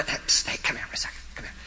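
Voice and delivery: unvoiced whisper to a child. Pastor sneaks to the kid's aisle, whispering to him. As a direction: call the kid over quietly so mom won't hear.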